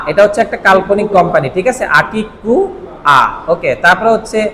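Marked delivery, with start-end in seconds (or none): 2.0-3.2 আকিকুয়া said very slowly, syllable by syllable; slow katakana reading. Shown as a demonstration. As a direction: drag out আকিকুয়া for learners. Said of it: "কি শব্দটা?".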